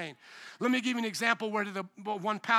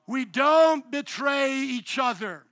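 each word slow and weighty, stress on don't; slow emphatic declaration; 3 semitones higher, 6 dB louder, much slower than usual. Real, not acted. Pastor to congregation, slow firm declaration. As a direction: lay down the rule slowly and firmly.

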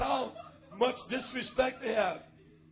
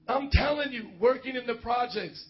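ordinary delivery

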